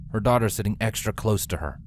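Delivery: plain reading voice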